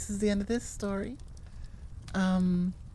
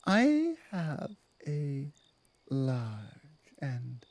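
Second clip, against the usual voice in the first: apologetic werewolf pack leader; 4 semitones lower than usual. Acted.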